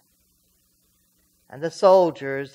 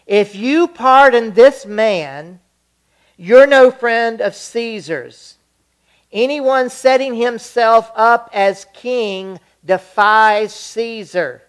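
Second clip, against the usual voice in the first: loud, higher, slow threatening yell; shouting down; 7 semitones higher, 10 dB louder than usual. Acted. The crowd to Pilate, threatening shout. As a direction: bellow the warning at him.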